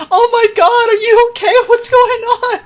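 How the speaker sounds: high, loud imitation of a worried friend; mock alarm. Friend answers a crying caller, acted alarm. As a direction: blurt it out in high-pitched concern.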